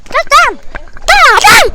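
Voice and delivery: loud high command; shouting. Real, not acted. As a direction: yell it out to stop someone. Calling out on the trail.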